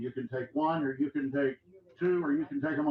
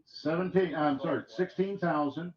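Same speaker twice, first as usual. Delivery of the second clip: rapid rhythmic auction chant; auctioneer; 7 semitones higher, 5 dB louder than usual. Acted. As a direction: roll the numbers like a bid caller. Auction bid calling.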